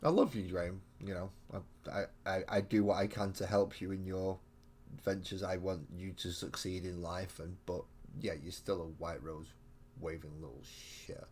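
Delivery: ordinary talk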